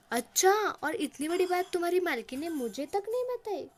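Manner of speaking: loud, high, hammed-up shock at trivial news; mock astonishment. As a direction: act as if the sky has fallen. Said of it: "जैसे आसमान से गिरा हो". (आसमान से गिरे जैसी नक़ली हैरानी, ऊँची और ज़ोरदार)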